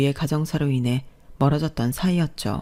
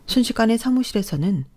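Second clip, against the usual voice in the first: ordinary delivery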